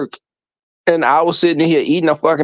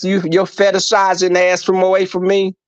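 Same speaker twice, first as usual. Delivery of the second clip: ordinary talk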